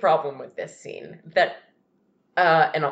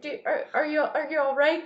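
put-on concerned little kid; 7 semitones higher than usual. Kid to his crying dad, concerned little voice. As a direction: stammer it gently, high and small. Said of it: "he's like".

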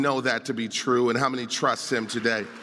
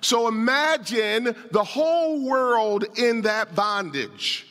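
higher, driving pulpit voice; raised, insistent sermon delivery; impassioned preaching; 7 semitones higher than usual. Real, not acted. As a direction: preach it with urgency, punching key words. Preaches on the passage.

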